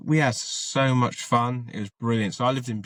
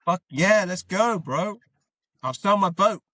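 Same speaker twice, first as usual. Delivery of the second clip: much higher and louder, buzzing with excitement; thrilled yes; 9 semitones higher, 3 dB louder than usual. Retold or imitated. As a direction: jump at the offer like a kid. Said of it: "excited reply to flight offer".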